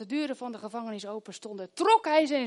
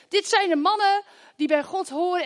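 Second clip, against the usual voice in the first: 높은 소리로 크게 외치는 여자; calling out; 7 semitones higher, 6 dB louder than usual. Retold or imitated. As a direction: shout it after them, higher and louder. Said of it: "die roept achter ze".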